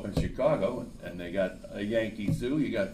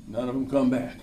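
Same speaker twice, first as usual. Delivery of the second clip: normal talking voice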